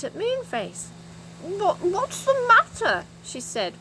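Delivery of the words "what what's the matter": high, slow words through a mouthful; muffled, puzzled question with a full mouth. Acted. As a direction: talk round a mouthful of cake. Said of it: "with her mouth full".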